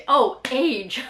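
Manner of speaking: loud "oh" of discovery; sudden realisation. Real, not acted. Vlogger working out a brand name, sudden realisation. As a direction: blurt it as it dawns on you.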